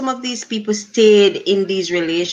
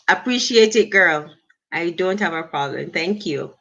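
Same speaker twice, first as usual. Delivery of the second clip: ordinary delivery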